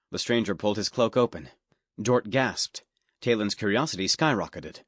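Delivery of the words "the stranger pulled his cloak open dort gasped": usual speaking voice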